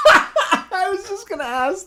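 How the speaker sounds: high, loud words through laughter; laughing. Real, not acted. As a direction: crack up while talking, stretching the words. Cracking up at the singing story.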